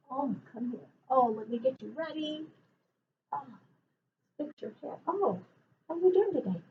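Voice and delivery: quietly fussing off to the side; hushed aside. Real, not acted. Fussing over the puppet off-mic.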